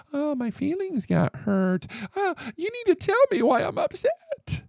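sneering imitation of hurt feelings; mocking whiny voice. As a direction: parody a fragile kid fishing for sympathy. Mocking an oversensitive generation.

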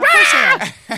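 high excited shout. Pretend. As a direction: shriek it like a fan. Mock fan excitement shout.

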